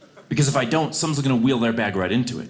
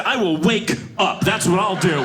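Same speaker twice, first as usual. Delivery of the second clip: shouted retort; 7 semitones higher than usual. Retold or imitated. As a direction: yell the obvious answer. Snapping back at attendant.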